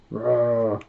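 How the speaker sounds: short imitated beast roar; voiced animal roar; bear roar. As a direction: let out a sustained mock roar. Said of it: "there's roaring in the distance".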